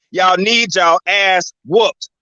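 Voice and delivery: drawn out, every word hammered slowly; slow emphatic rant. Real, not acted. To the viewers about check writers, a slow scolding drawl. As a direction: drag it out like a scolding. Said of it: "now I gotta tweak for a little minute".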